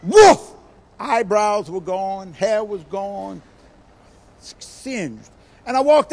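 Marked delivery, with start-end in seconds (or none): 0.0-0.5 loud, high burst of igniting fuel; whoosh of flames. Retold or imitated. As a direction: imitate fire flaring up suddenly. Imitates gasoline bursting into flame.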